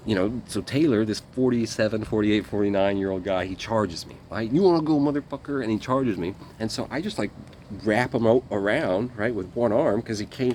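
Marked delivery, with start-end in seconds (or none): 4.5-5.6 loud, high, taunting dare; mock fight challenge. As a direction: throw down the challenge.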